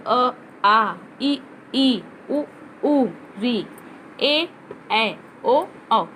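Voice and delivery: each vowel said slowly and clearly, अ आ इ ई उ ऊ; name each vowel one by one, ए ऐ ओ औ; reciting the vowels. Shown as a demonstration. Teacher reciting vowels to pupils, clear and slow. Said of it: "स्वरों का रूप देखिए".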